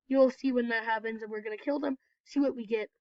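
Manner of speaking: ordinary talk, nothing marked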